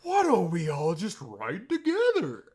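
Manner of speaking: high, loud mocking preachy voice; mock Brother Mike. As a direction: ham up the pushy churchy guy.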